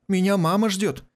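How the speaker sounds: high whiny cry to be let go; whining. Acted. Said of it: "захныкал Касмаля".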